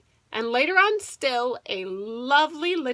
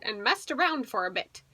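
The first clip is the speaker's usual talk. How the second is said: usual speaking voice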